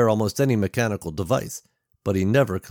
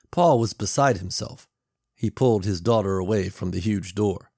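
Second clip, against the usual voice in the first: usual speaking voice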